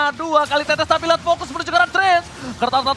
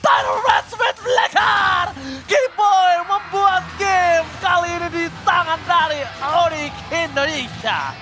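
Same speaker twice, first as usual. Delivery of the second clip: fighting game announcer; 6 semitones higher than usual. Real, not acted. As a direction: roar the wipeout to the arena. Caster calls the game-winning fight.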